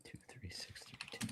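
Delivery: whispering. Real, not acted. Repeating the badge number.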